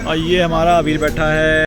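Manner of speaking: ordinary talk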